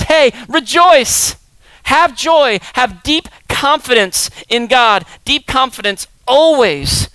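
loud, slow, high rallying call; fervent preaching. Acted. Paul calling from prison to rejoice, slow and loud. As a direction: stretch each word like a summons.

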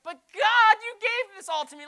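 high, loud mock protest; protesting to God. Pastor retelling arguing with God, hammed-up loud protest. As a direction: cry out, indignant at God. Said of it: "And I went".

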